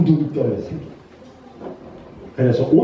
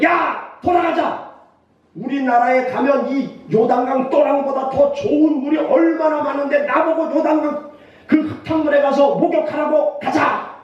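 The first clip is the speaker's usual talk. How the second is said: high-pitched indignant outburst; acted anger; 8 semitones higher than usual. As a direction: order your men home in a high, offended rant. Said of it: "그러면서 막 화가 나가지고". (화가 나서 높은 소리로 외치듯)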